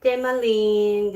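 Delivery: drawn-out loud call; calling out name. Real, not acted. Calling goodnight to viewers.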